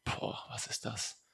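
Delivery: slow breathless whisper of wonder; retold gasp of amazement, whispered; awed whisper. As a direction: hush it like you can't believe what you see. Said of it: "hab ich gesagt".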